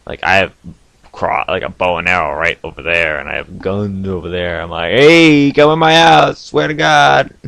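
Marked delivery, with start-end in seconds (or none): 4.9-7.3 loud, high challenge to an intruder; hammed-up warning yell, sustained and raised; mock threatening shout. Daring an intruder to come in his house, mock-threatening yell.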